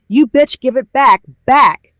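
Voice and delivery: loud, high-pitched furious demand; angry outburst. A narrator's reading. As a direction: shout it like a brother charging in. Enraged brother charges at narrator.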